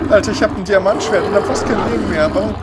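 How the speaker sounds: panicked shouting. Real, not acted. Panicked gamer attacked by monster.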